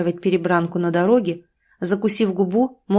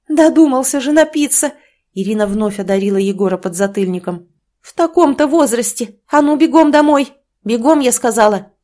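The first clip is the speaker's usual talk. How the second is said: furious yell; 4 semitones higher, 6 dB louder than usual. Acted. Mother yelling at son.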